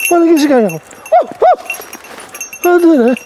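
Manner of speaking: high, loud urging shout. Real, not acted. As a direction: yell to your partner to join in. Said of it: "Εγώ φωνάζω".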